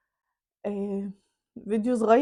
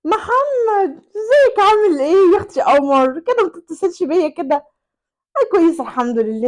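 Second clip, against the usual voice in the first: much higher, louder flirty chatter; flirty mistress; 7 semitones higher, 8 dB louder than usual. Acted. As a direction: tease him down the phone, playful. العشيقة تغازل محمد على الهاتف. (العشيقة تدلع محمد على الهاتف بصوت عالٍ)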